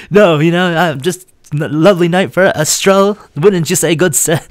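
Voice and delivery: high, loud, overly polite innocent act; a hammed-up gentleman talking his way out of a sobriety check; fake sober charm. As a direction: play it cool and proper to fool the cop. Faking sober politeness to officer.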